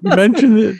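high squealing, laughing glee; giddy delight. Retold or imitated. Friend gushing to the podcast hosts, squealing with laughing delight. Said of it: "I was like".

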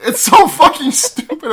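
slow high laughing rant. Real, not acted. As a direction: laugh through the words. Laughing complaint.

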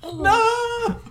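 very high mock wail; hammed-up cry of despair. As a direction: wail it like a doomed player. Trapped player hears the clue.

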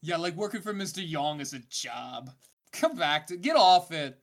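laid-back surfer dude. Acted. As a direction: play it like a chill surfer. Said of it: "Where's my surfer voice?".

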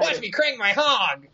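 hammy joke voice. Pretend. Joke character voice.

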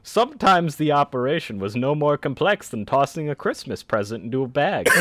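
put-on high, loud old-fashioned announcer; old-timey voice. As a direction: proclaim it like an old newsreel. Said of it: "we want it in the old-timey voice".

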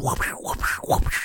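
unpitched whooshing "whoop" noises; imitated whip cracks. Host acting out the flogging to a friend, imitated whip noises. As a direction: mimic a whip swishing and landing. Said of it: "he's just fucking flogging him".

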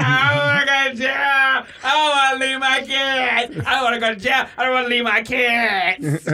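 high, wailing imitated bawl; pleading through exaggerated sobs; talking through mock crying. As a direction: blubber the words in a hammed-up cry. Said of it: "then you talk a little bit".

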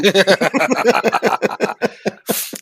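loud high laughter; hearty laugh. Real, not acted. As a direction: crack up at the joke. Laughing at the story.

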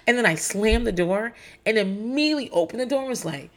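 normal talking voice

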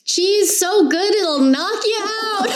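high, slow laughing quip; chuckling. Acted. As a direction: chuckle through the punchline. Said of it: "Jolie just chuckles".

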